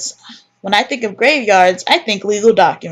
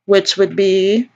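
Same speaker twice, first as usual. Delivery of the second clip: usual speaking voice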